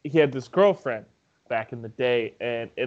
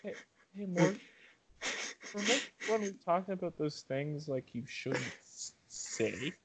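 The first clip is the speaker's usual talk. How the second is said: hushed warning; 12 dB quieter than usual. Retold or imitated. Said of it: "I was like".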